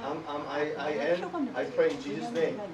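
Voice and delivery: low, soft stammering prayer; hesitant muttered prayer; mock hesitant prayer. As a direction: fumble the prayer quietly. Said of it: "And then I say".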